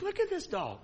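high, soft, amazed murmur; marveling aside. Retold or imitated. Watching the dog go home, a high soft marvel. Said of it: "And I'm like".

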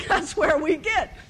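usual speaking voice

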